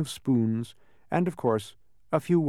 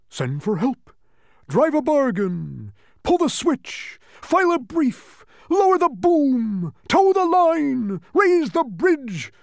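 very high, loud, drawn-out cries; frantic bug shouting slogans; excited shouting; 9 dB louder, slower than usual. A narrator's reading. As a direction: yell each order jumping up and down. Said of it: "shouted the bug, jumping up and down".

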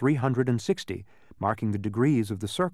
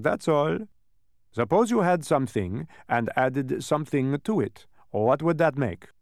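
dodecahedron, explaining; 3 semitones higher, 4 dB louder than usual. Acted. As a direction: explain it emphatically, like a lecturer. Narrator voices the dodecahedron.

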